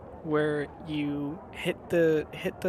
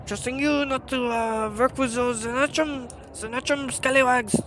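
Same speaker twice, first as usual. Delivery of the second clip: high, loud, bitter guild rival; grudge-holding NPC; 7 semitones higher, 11 dB louder than usual. Acted. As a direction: spit out hatred for rival guild. Quest giver ranting about rivals.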